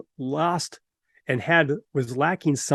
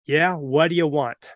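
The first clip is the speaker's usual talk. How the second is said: ordinary talk, nothing marked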